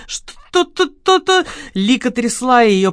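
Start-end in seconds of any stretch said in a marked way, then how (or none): 0.1-1.5 high, broken syllables; frightened words crumbling to bits; shaken stammer. A narrator's reading. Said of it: "все слова рассыпались на кусочки".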